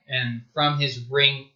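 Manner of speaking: ordinary talk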